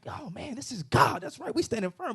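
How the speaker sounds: high-pitched voicing of awed followers; mock confidence, pitched well up; acted bold bravado. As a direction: play excited apostles standing their ground. Voices apostles emboldened by miracles.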